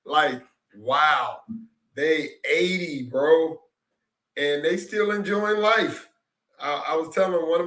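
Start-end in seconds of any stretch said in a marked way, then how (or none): none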